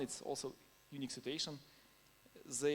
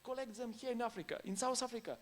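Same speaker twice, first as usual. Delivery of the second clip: retold urging; 7 semitones higher than usual.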